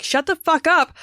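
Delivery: high and loud, shushing the rude guests; mock yell. Friend scolding partygoers at Nick's party, loud. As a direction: snap at them to shut up, half-joking. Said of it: "you're probably gonna be like".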